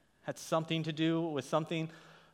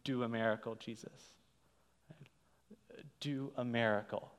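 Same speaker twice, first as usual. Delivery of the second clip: hushed hopeful plea; 4 semitones lower, 7 dB quieter than usual. Retold or imitated. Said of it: "sitting there probably going".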